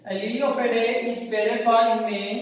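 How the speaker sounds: reads the line slowly in one breath; slow reading. Shown as a demonstration. Teacher reads the full poem line slowly to class. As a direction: read the whole line slowly and evenly. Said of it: "वो पढ़ते हैं".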